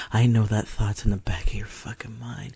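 breathy whispered taunt; seductive whisper. Acted. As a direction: whisper it close and teasing. Whispers intimate roleplay lines.

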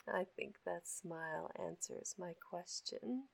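low, hushed afterglow whisper; soft whisper. Acted. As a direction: murmur close and tender.

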